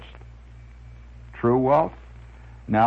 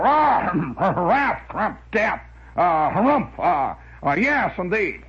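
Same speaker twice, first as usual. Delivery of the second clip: higher-pitched harrumphing blowhard; windbag character; 4 semitones higher, slower than usual. Retold or imitated. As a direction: sputter nonsense like a pompous comic-strip windbag. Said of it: "I could still do it".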